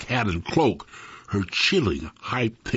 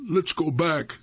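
higher-pitched, mildly frightened plea; a touch of trembling fear; 6 semitones higher than usual. A narrator's reading. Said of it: "his voice trembling".

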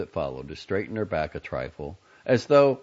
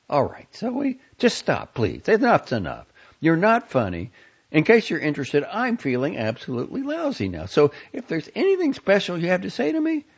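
put-on high, pleading young woman losing patience; Franny fed up; 13 semitones higher, 5 dB louder than usual. A narrator's reading. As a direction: beg him to stop, higher and exasperated. Franny exasperated on the phone.

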